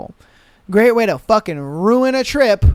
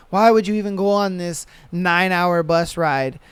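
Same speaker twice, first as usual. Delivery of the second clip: usual speaking voice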